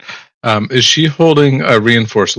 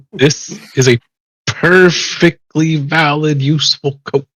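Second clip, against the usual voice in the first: mock indignation; 5 semitones higher, slower than usual. Player defends coat to fashionista.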